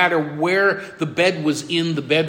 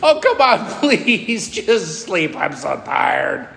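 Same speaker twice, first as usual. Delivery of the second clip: weary pleading; slower than usual. Retold or imitated. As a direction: beg like an exhausted parent, slowing as it drops. Tired father begs child to sleep.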